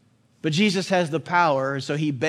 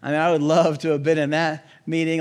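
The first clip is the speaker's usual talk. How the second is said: ordinary talk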